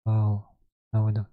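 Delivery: ordinary delivery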